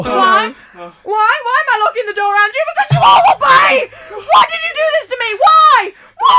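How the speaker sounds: very high, loud screaming; unhinged villain rant. Acted. Host locks the door and screams at the players, unhinged. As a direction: scream at them, losing it.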